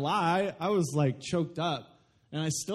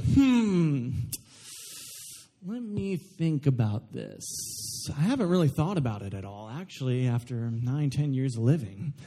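mock hesitant dad; 5 semitones lower than usual. Mock father pondering his love.

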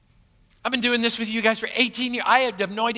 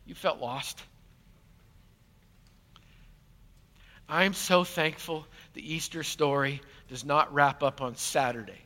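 hushed; 6 semitones lower, 6 dB quieter, slower than usual. Real, not acted. Preacher softens at low point.

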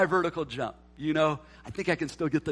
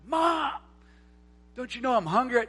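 high-pitched mock kid's cry; whining child; 8 semitones higher than usual. Hungry child freaking out at mom, mock whining. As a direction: play a hungry kid calling out in a panic.

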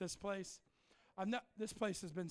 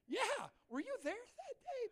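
high, slow squeal; playful challenge; slower than usual. Real, not acted. Preacher teasing the congregation for its response, high drawn-out squeal. Playful reply to audience reaction.

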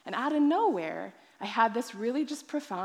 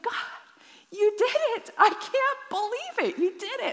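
high, louder, breathless delight; joyful disbelief; 11 semitones higher, 3 dB louder than usual. Retold or imitated. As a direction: thank God in giddy wonder. Laughing joy at answered prayer.

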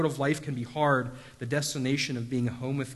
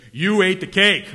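slow, louder pinning it down; stern accusation; 4 semitones higher, 10 dB louder than usual. Retold or imitated. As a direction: interviewer repeating it back like a verdict. Interviewer presses on the cake.

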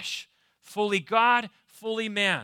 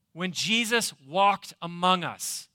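usual speaking voice

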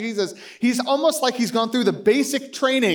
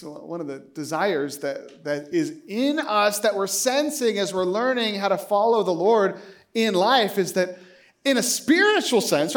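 usual speaking voice

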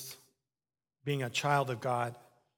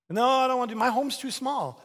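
high, loud whiny protest; loud pleading protest; 9 semitones higher, 7 dB louder than usual. Acted. Preacher voices a believer arguing with God, loud whine. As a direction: protest like a stubborn child.

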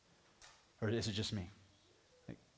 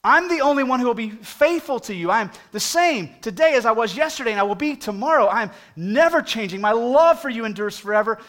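much higher and louder, a ringing promise; voicing Jesus; 10 semitones higher, 12 dB louder than usual. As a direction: proclaim it as Jesus calling everyone. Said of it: "Jesus says".

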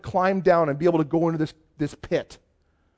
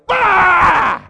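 sudden high, loud bark of a yell; mock lion-scaring shout; 13 semitones higher, 9 dB louder than usual. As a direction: blast it out to scare off a lion. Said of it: "he faces the lion and goes".

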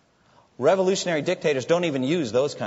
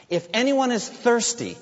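high, raised proclamation; proclaiming loudly; 10 semitones higher, 4 dB louder than usual. Retold or imitated. Jesus calls to the crowd at the feast, proclaiming loudly. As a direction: call it out to a crowd. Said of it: "said in a loud voice".

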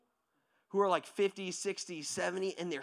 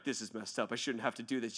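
low, softer mock complaint; reluctant grumble; 9 semitones lower, 7 dB quieter than usual. Person made to forgive unwillingly, low reluctant grumble. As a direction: drop down and grumble under protest.

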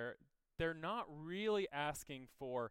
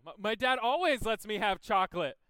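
put-on child's plea, louder and higher; whiny kid pleading; 5 semitones higher, 10 dB louder than usual. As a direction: beg like a toddler denied chocolate. Three-year-old pleading in story.

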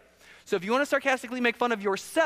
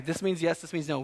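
usual speaking voice

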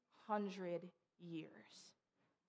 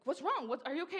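retold alarm; 7 semitones higher, faster than usual. Alarmed reply to frantic phone call.

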